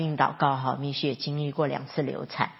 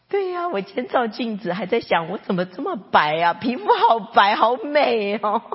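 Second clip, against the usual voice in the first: mother's voice; 5 semitones higher, 5 dB louder than usual. Retold or imitated. Mother telling her son she looks pale and pretty, lightly voiced. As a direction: play a sunny, upbeat mother. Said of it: "然后妈妈就这样说".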